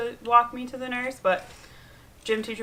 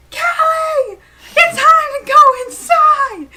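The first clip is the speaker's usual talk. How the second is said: high, loud scream calling her inside; shrill shout to come inside; 12 dB louder, slower than usual. Retold or imitated. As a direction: yell it across the playground like a furious supervisor. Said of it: "screaming at me".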